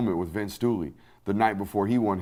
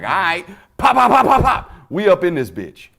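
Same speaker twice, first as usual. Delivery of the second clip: mock gunshot bravado; 8 semitones higher, 10 dB louder than usual. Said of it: "just being like".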